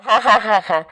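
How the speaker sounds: put-on loose drunken cackle of a tipsy uncle; drunken laugh. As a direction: laugh it off like a man who has had a few.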